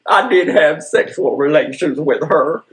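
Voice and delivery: a hint of Clinton, now admitting it; a touch of a politician confessing; mock Clinton confession. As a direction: lightly imitate the president owning up.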